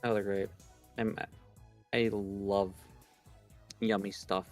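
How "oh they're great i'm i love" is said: normal talking voice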